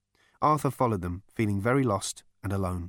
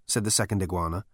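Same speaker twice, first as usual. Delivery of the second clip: plain reading voice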